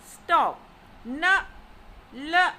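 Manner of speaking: "stop", "N", "l" each punched out; falling-tone drill on "stop", "N", "l"; command. Shown as a demonstration. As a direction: bark "stop", "N", "l" as orders. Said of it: "pronounced like a command".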